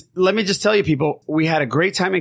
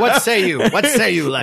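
mock royal judge; 7 semitones higher, 4 dB louder than usual. Mock royal trial questioning.

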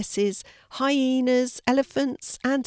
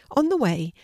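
ordinary delivery

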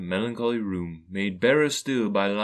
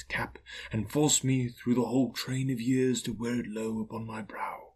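airy, otherworldly ghost, gently indignant; reproachful ghost. Acted. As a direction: voice a wounded spirit, fading away at the end. Voices the Ghost in story.

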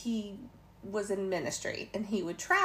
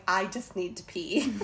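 laughing aside; 6 dB louder than usual. Retold or imitated. Retelling inner thought, laughing.

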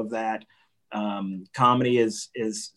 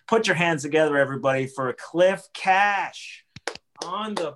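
announcer intro; 5 semitones higher than usual. Acted. Host introduces guest.

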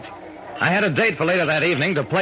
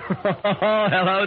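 laughing hello; 6 semitones higher than usual. Real, not acted. Laughs greeting a Santa.

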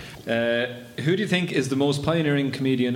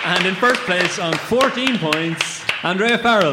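higher, louder, drawn-out announcer call; winner announcement; 5 semitones higher, 5 dB louder, slower than usual. Real, not acted. Host announcing the story competition winner, loud and drawn out. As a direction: build it up like a prize reveal.

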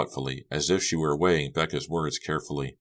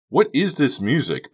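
high, loud over music; shouting back; 10 semitones higher, 7 dB louder than usual. A narrator's reading. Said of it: "Becca shouted back".

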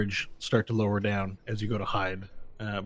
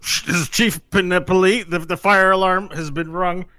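intercom announcement; 6 semitones higher, 5 dB louder than usual. Acted. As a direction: announce it over a loudspeaker. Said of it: "the intercom comes on".

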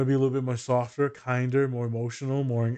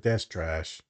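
low dismissive verdict; 10 semitones lower, 4 dB quieter than usual. Retold or imitated. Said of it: "And I'm like".

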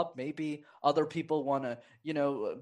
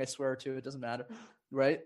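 ordinary talk

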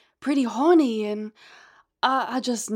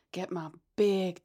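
ordinary talk, nothing marked

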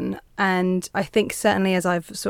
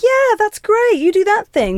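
much higher, mock gushing cheerleader; gushing approval; 12 semitones higher, 4 dB louder than usual. Podcast host mimicking someone affirming him, gushing mock praise. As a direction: coo out fake praise like a fan. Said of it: "trying to get someone to go like".

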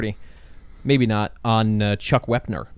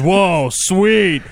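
higher, louder mock amazement; mock awed clip voice; 6 semitones higher, 5 dB louder than usual. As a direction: ham up the guy's awe at the cockpit. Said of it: "He sees all the dials".

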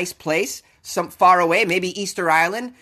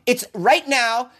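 normal talking voice

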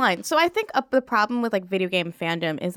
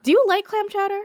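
much higher Boston accent, hammed up; cartoon Boston accent; 12 semitones higher, 3 dB louder than usual. Asking cohost about chowder in a hammed-up Boston accent. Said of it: "cartoon character version of a Boston accent".

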